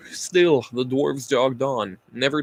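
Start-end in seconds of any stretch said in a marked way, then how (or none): none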